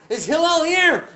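loud, high shout through a door; calling out. Acted. Visitor banging on Hillel's door, calling out loudly.

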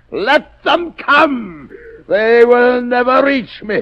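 slow, high, taunting; slow gloating defiance. Acted. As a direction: savor the defiance slowly.

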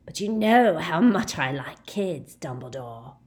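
lightly voiced menacing werewolf. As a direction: savour the threat.

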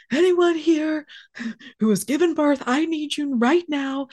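high-pitched, panicky call for help; retold frantic yell. Partner yelling upstairs for help with the birth, frantic. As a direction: imitate a panicked man calling upstairs. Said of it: "He's freaking out".

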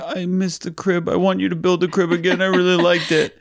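high, pleading child voice, voicing a daughter; a tearful kid begging for her crib back; upset little girl. Dad voicing daughter Lucy begging to rebuild the crib, high child whine. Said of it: "she came down and was crying her eyes out".

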